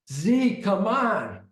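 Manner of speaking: high, loud plea; exasperated yell. Retold or imitated. Gunman's friend urging Zeke to stop, exasperated shout. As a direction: yell at him to quit it.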